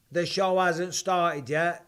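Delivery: drawn out slowly, each word leaned on; slow, emphatic repeat. Real, not acted. Insisting to the viewers that the show is yet to start, slow and emphatic. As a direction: spell it out slowly so they get it.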